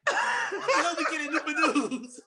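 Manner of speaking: high, slow question broken by laughter; laughing disbelief. Retold or imitated. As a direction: ask it while cracking up. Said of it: "I was like".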